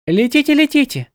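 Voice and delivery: high, cooing birdlike goddess. A narrator's reading. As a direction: coo the words like a dove. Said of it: "проворковала белая полубогиня".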